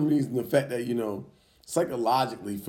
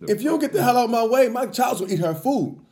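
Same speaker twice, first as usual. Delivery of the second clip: much higher and louder, yelling at the lady; retold shout at teacher; 9 semitones higher, 4 dB louder than usual. Father yelling at the teacher blocking his daughter's food, loud. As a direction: go up and loud, daring her to block you.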